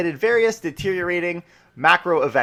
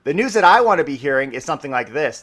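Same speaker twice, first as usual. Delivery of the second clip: usual speaking voice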